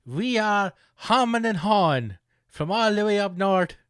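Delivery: high, loud hammed-up Irish stage voice; a bit Irish. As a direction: greet the crowd as a cheesy Irish folk duo. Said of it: "they got a bit Irish".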